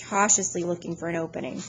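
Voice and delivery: usual speaking voice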